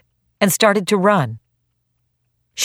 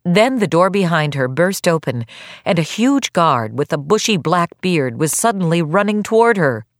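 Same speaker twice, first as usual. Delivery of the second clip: usual speaking voice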